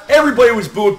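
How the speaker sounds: ordinary talk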